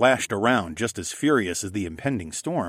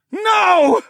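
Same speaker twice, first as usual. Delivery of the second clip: high, very loud cry; full-force drawn-out yell; desperate shout; 10 dB louder than usual. A narrator's reading. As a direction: scream refusal with everything you have. Narrator cries out as partner falls.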